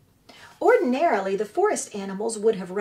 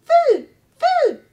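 high, loud little chick cry; peeping gosling; 11 dB louder than usual. Acted. Newborn gosling calling to the robot, high peeping cry. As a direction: peep it out like a hungry baby bird. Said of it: "peeped the gosling".

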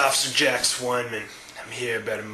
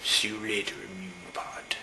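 soft unvoiced whisper; 4 semitones lower, 9 dB quieter than usual. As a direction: breathe it out as a secret. Officer's hushed farewell.

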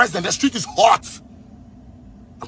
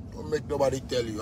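dropped low and much softer, almost under his breath; a lowered, menacing warning; 9 semitones lower, 12 dB quieter than usual. Real, not acted. Ranting about the country's leaders, sinking to a low murmur.

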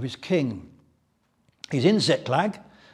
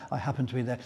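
quiet wry repeat; 4 semitones lower, 6 dB quieter, much faster than usual. Shown as a demonstration. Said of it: "laughs then repeats quietly".

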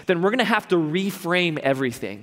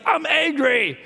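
high, tight mock anger; mock angry; 10 semitones higher, slower than usual. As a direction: play the grumpy mad voice, half joking. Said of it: "You're just like".

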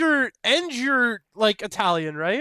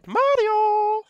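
high cartoon shout of Mario; Luigi voice; 12 semitones higher, 5 dB louder than usual. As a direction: yell it like Luigi calling his brother. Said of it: "I'm Luigi".

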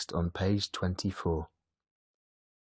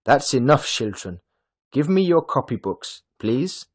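loud, higher-pitched teacher calling a halt; brisk classroom command, raised voice; teacher's voice; 5 semitones higher, 8 dB louder than usual. A narrator's reading. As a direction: call out firmly to the whole class. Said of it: "he heard Frau Hoch's voice".

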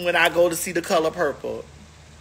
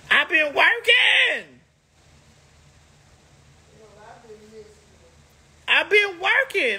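emphatic high call; 6 semitones higher, slower than usual. Real, not acted. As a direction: belt out I've been working, drawn out and high. Insisting she has been busy.

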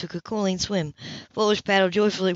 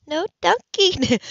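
much higher, drawn-out playful protest; mock alarm; 9 semitones higher, much slower than usual. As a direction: squeal a half-serious no as he grabs her. Anna fends off dunking.

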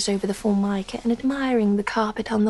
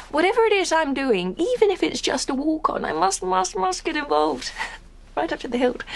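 higher-pitched, impassioned actress's declaration; earnest insistence; 5 semitones higher than usual. Acted. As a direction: insist on your total dedication, pitch raised. Actress earnestly insisting on her dedication.

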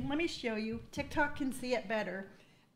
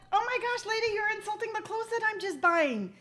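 indignant; 9 semitones higher than usual. Retold or imitated. Retold indignant reply to cashier.

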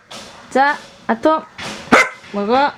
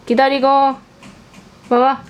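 normal talking voice

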